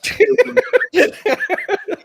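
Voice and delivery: helpless, high-pitched laughter; high laughing fit. Real, not acted. Host cracks up at co-host's bad impression, high laugh.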